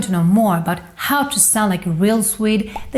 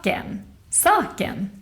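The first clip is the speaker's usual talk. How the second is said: SAKEN stressed on the first syllable, then falling; acute accent; 6 dB quieter than usual. Shown as a demonstration. Teacher giving an example word, acute accent on "Saken". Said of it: "we put the stress in the first syllable".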